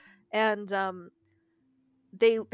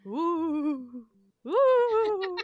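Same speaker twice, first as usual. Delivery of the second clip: long, high wavering ghost wail; mock-haunting drawn-out "ooooh"; spooky ghost "ooh"; 8 semitones higher, 4 dB louder than usual. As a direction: playfully wail like a ghost, rising higher. Ghost noises after "It's spooky.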